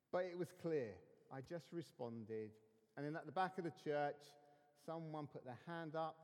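ordinary talk

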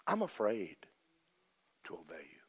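soft, falling, near-breaking plea; a mild hint of weeping as he prays; retold tearful prayer. Preacher retelling his prayer to God, softly tearful. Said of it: "I just wept over my steering wheel".

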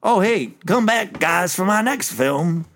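high-pitched movie sign-off, slowing down; Ernest. Acted. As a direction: pitch it up and stretch out the film title. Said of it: "post thing where he goes".

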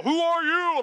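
lightly hammed-up, high challenge; mock divine rebuke. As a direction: imitate an indignant God calling someone out. Mimicking God rebuking Job.

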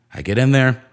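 ordinary talk, nothing marked

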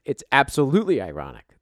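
ordinary talk

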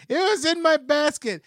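high-pitched imitated protest; mock old lady. Old lady protesting to the manager, high mock voice.

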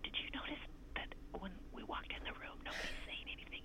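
hushed whisper to her husband; wife whispering to dad in the hushed office. Retold or imitated. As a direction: whisper so the silent office won't hear. There is a hush.

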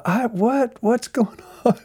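high, stunned what; startled pastor asking what is going on; confused protest. Retold or imitated. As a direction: caught off guard and bewildered. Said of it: "I'm going".

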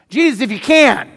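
mock-pleading imitation; mocking the doubter. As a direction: parrot his doubtful prayer back mockingly. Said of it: "Is that how you pray?".

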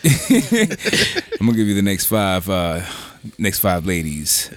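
sexy voice. Pretend. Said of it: "they hear that voice".

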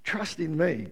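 soft, low, gentle Jesus plea; tender, quiet voice of Jesus; tender invitation. Retold or imitated. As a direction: invite them in softly. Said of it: "he comes to us and he says".

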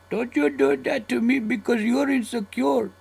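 put-on lightly done Indian accent, pitched high. Convenience store clerk calling out a coworker, mock Indian accent. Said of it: "It would probably be in an Indian accent".

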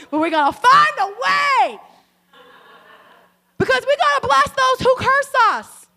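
high, loud and slow declaration; fervent shout. Real, not acted. Pastor promises the story will reach the sermon, shouting it out. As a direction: vow it to the congregation. Sermon vow, rising fervour.

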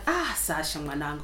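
low, soft, teasing mum voice; imitating mum. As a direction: sigh it out like a knowing African mum. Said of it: "my mum will be like".

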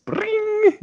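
high-pitched ringing computer ding; ping it out like a machine's answer bell; computer chime. Pretend. Imitates computer producing an answer.

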